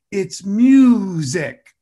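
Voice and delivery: "music" said slowly and loud; drawn out. Retold or imitated. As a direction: stretch out "music" pleadingly. Son stresses music.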